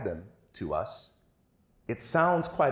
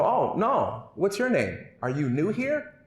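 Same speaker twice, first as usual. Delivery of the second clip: higher, puzzled classmates; bullies' voices; 8 semitones higher, 3 dB louder, slower than usual. Acted. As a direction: ask it like puzzled kids, pitched up.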